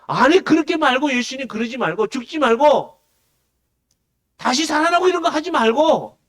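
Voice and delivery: high, loud acted pleading; Peter protesting. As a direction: cry out in insistent mock protest. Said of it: "얘기를 하는 겁니다".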